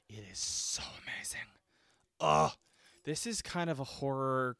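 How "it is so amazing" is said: breathless whisper of awe; hushed whisper about a film she adores. Real, not acted. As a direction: whisper it like a secret delight. Gushing about the movie.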